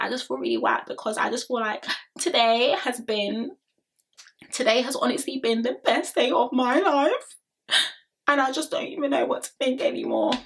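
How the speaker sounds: half-mocking a contestant crying. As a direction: mimic her tearful wobble as a joke. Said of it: "And then she cried again. Emphasis on the cried".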